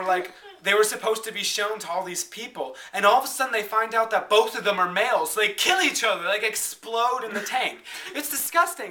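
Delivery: ordinary delivery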